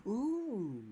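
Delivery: high-pitched, drawn-out wowed reaction; mock eagerness. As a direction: pretend to be suddenly tempted. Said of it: "makes me go".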